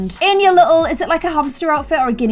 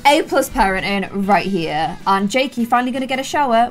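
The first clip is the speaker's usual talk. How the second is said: normal talking voice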